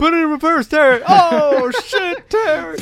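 very high, loud comic screaming; hammed-up panicked shouting. Joking about a car in reverse, mock panicked screaming. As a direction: escalate the holler into mock alarm. Hollered joke impression repeated, escalating.

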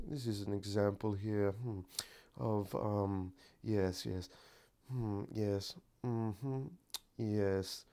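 hushed British radio intellectual, halting; Brit on NPR. Shown as a demonstration. Showing how to pretend on NPR, hushed mock-British musing. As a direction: murmur musingly in a put-on British accent. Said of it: "Pretend you're a Brit on N P R".

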